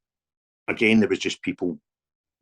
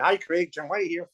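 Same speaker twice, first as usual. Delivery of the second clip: higher-pitched mimicked phone greeting; 6 semitones higher than usual. Shown as a demonstration. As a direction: demonstrate the caller's introduction, pitched up.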